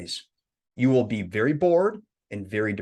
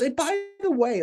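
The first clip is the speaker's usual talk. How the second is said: friend's voice. Retold or imitated. Friend calling.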